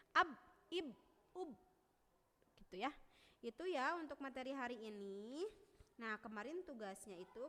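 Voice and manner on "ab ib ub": "ab, ib, ub" cut off on the b; stopped ab demo. Shown as a demonstration. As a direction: stop the b dead. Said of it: "yang asyiddah yang tertahan".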